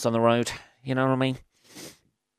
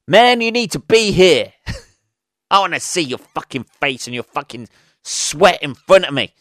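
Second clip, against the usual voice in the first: loud, higher New York tough guy demanding a meeting; dodgy New York accent; 4 semitones higher, 5 dB louder than usual. Retold or imitated. New York boss insisting on meeting face to face, dodgy New York accent. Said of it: "Dodgy New York accent there".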